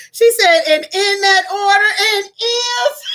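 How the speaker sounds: high, excited squeal building to laughter; gleeful high pitch; tickled delight. Real, not acted. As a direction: read it out rising, about to crack up. Reading comment while cracking up.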